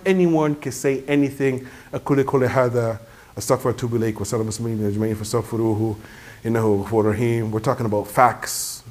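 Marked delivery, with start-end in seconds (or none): 1.9-7.5 low, soft, rapid formula; hushed, run-together liturgical Arabic; closing Arabic prayer. Real, not acted. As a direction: murmur the familiar closing supplication quickly.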